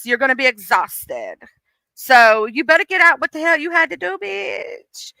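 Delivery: coaxing, sing-song fake-sweet voice; sweet sleepy exhaustion. Exhaustion sweet-talking its owner into a nap. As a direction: lure them into a nap, sugary. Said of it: "She's like".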